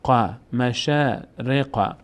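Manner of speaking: مشارق spelled to its end slowly; slow recitation. Shown as a demonstration. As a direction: teach مشارق syllable by syllable. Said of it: "ر کسره داره".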